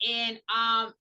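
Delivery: usual speaking voice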